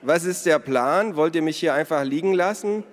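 loud, high-pitched protest; indignant calling. A narrator's reading. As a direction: call out in outraged complaint. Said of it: "rief Ada von der Tür".

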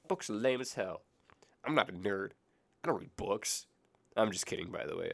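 normal talking voice

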